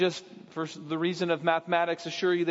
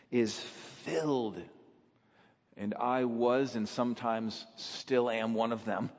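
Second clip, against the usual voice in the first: ordinary talk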